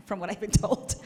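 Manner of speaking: loud, breaking into laughter; laughing. Real, not acted. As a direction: laugh through the words at your own joke. Laughs at her own joke.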